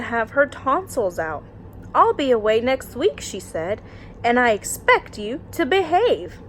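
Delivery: lively storytime read-aloud; story narration. Acted. Teacher reading a bedtime picture book to her class, lively storytime voice.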